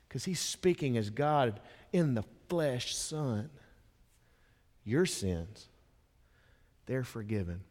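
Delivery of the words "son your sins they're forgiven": gentle forgiveness. Acted. Jesus forgiving the man's sins.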